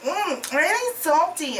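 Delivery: high, slow put-on woman's voice; mock secretary voice. Reviewer mimicking the secretary tasting candy, high mock voice. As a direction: savor it in a high caricatured voice. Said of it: "And she's like".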